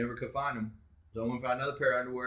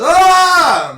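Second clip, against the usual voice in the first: loud high scream; yell; 20 dB louder than usual. Retold or imitated. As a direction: scream at the man by the bed. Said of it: "I yelled".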